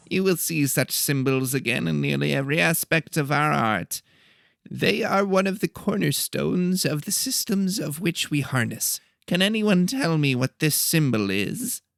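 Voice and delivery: a fussy little teacher calling the class to order; nasal professor. A narrator's reading. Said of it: "He talked in a nasally voice".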